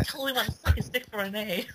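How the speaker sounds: whisper-gossip like a giddy student; mock whispering girl. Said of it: "like, a whispering tone".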